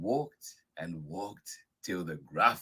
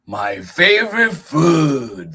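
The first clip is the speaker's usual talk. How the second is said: Gruffalo; 9 semitones higher, 14 dB louder, slower than usual. Acted. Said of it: "the Gruffalo said".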